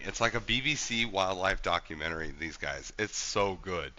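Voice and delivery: ordinary talk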